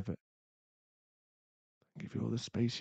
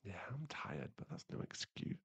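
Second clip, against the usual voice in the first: soft whisper to himself; guilty whisper; self-blaming whisper; 2 semitones lower, 15 dB quieter than usual. Acted. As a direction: whisper the excuse away.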